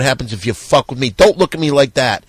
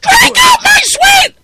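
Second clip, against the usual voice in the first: shrieked high and loud, almost crying; Quincy says drink up in a screaming falsetto; screeched toast; 6 dB louder, slower than usual. Acted. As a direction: scream the sweet offer like a deranged villain. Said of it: "Quincy says".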